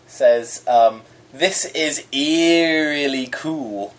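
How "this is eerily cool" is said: slow spooky read. Acted. Reading listener's ghost pun.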